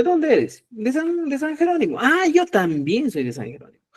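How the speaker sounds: high-pitched pretend chat; acted sample dialogue. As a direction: act out discovering a shared hometown, voice up. Acts out coincidence of origin.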